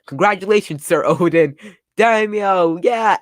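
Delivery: high, loud congratulations; acted cheering. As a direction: cheer him on like a crowd of fans. Voicing followers cheering for Oden.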